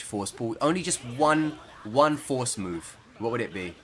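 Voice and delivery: normal talking voice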